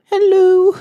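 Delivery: very high, loud mock Finnish accent; mum's Finnish accent. Shown as a demonstration. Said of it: "I could do your mum's accent".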